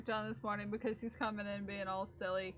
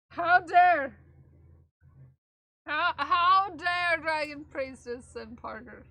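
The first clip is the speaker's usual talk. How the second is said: mock outrage; 3 semitones higher, 8 dB louder, slower than usual.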